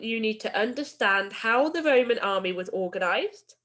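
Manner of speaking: ordinary delivery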